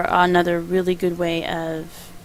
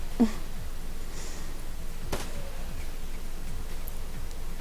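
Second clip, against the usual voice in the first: soft airy laugh; breathy giggle; 4 semitones higher, 8 dB quieter than usual. Real, not acted. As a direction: laugh it off quietly. Laughing at own dead air.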